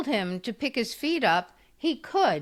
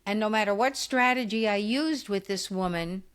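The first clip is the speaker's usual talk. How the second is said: ordinary talk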